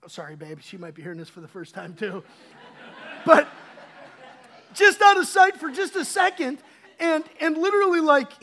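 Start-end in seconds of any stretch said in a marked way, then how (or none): none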